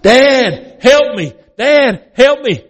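high, loud repeated cries for help; trapped son screaming to his father. Retold or imitated. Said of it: "Jay is screaming at the top of his lungs".

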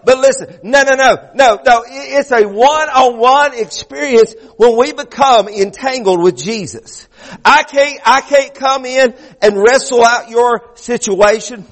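high, driving sermon delivery; fervent preaching. Real, not acted. Preacher urging the congregation about Jacob wrestling God, fervent preaching. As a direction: preach it hard, stretching the key words.

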